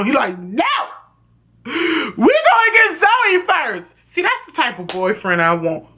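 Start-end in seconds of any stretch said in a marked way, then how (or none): 0.4-3.9 high, drawn-out stubborn cry; mock desperate insistence. Noah refuses hospital for his girlfriend, mock desperate insistence.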